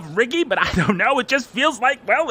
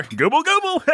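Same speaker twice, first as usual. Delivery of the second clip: higher, playful turkey gobble; mock turkey gobble; 10 semitones higher than usual. Jokes about the chicken dinner.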